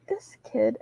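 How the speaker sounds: soft unvoiced whisper. Mom muttering about her son, in a whisper. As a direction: breathe it out under your breath. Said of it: "I'm like".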